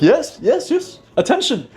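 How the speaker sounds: put-on a ten-year-old delighted by the crowd's reaction; squealing with excitement, pitched very high; thrilled kid. Kid hooked on the attention.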